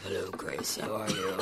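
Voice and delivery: put-on older voice. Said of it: "what I picture you're gonna sound like in thirty years".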